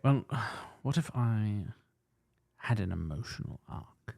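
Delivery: soft, sighing sad-boy impression; Hugh Grant. Mocking Hugh Grant's sad boy turn, soft sighing impression. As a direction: sigh and hesitate like a mopey leading man. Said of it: "goes into sad boy".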